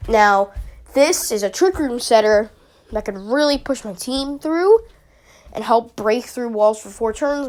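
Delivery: usual speaking voice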